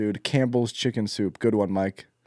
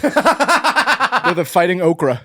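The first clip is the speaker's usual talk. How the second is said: laughing; 6 semitones higher, 4 dB louder, much slower than usual. Real, not acted. Announcing answer while laughing.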